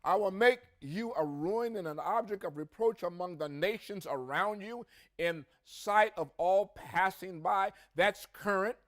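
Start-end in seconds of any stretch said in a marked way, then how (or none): 0.0-7.7 raised, driving scripture reading. Acted. Preacher reading Ezekiel aloud to congregation, proclaiming with force.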